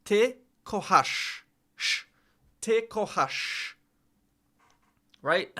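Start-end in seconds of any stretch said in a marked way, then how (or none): 0.0-1.4 "Ty kochasz" said slowly, "Ty" stressed and "sz" as sh; careful Polish. Shown as a demonstration. Practising Polish for the tutor, slow and careful. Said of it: "To say you love". 2.6-3.7 "Ty kochasz" said slowly, "Ty" stressed and "sz" as sh; careful Polish. Shown as a demonstration. Practising Polish for the tutor, slow and careful. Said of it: "To say you love".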